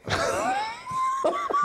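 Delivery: high shrieking laughter; big laugh. Real, not acted. Friend cracks up telling the story, shrieking laughter. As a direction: burst out laughing at the memory.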